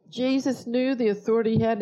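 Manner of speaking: ordinary talk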